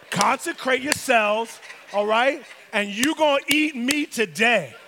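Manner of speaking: "Consecrate yourselves" slow, punched word by word; clapped-out emphasis. Acted. God orders the people, "Consecrate yourselves" hammered out. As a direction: lay down "eat meat today" loudly, beat by beat. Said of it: "God was clapping his hands".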